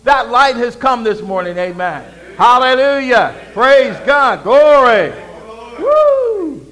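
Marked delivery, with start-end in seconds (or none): 2.4-6.6 loud praise ending in a high whoop; real exultant shout. Real, not acted. Preacher praising before congregation, exultant shouting. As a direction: shout it out in praise, building louder.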